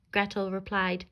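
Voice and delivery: ordinary delivery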